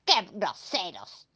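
queja indignada de monstruo; refunfuña ofendido; quejándose. Acted. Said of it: "se quejó". (queja de monstruo ofendido)

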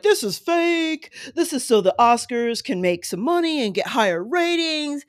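high, sneering mock quote; mocking idiots. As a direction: mimic a know-it-all, mocking. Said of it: "idiots out there who are like".

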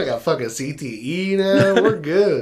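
high, loud laughing joke; high, loud laughing mock boast about brain damage. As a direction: laugh through the mock brag. Joking about brain injury.

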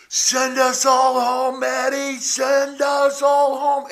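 loud, slow, high calling out; stretched-out stadium yell; yelled from the stands. Retold or imitated. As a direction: call it down from the upper deck. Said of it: "And I had yelled from the second tier up".

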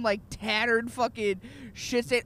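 ordinary talk, nothing marked

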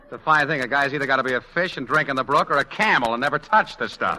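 ordinary talk, nothing marked

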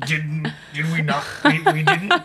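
high put-on sage voice breaking into laughs; Yoda. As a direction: fumble the backwards grammar in a creature voice. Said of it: "It's a good Yoda".

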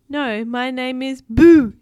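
slow, loud, emphatic creature voice; insist, booming out the last word; loud spooky insistence; insistent. A narrator's reading. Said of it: "insisted the creature".

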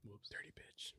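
very soft, voiceless whisper; whispered aside. Real, not acted. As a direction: whisper it under your breath. Muttered aside after a mishap.

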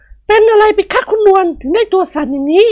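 high woman's voice, lightly concerned; gentle question. Acted. Woman asking her trembling friend, high gentle voice. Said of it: "ประไพกล่าวถามเบาๆ".

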